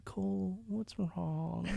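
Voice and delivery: hushed, tender mock concern; concerned wife. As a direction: imitate a wife gently checking in. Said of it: "She goes".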